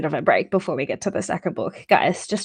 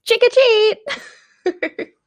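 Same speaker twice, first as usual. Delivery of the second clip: very high, drawn-out kiddie voice; hammed-up little boy trick-or-treating; little boy's cry; 4 dB louder, much slower than usual. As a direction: squeal it slowly like an excited small child. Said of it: "it probably sounds more like".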